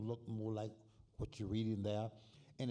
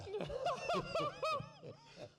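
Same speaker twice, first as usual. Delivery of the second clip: 1 high laugh; 3 dB louder than usual. Real, not acted. Laughs at own joke.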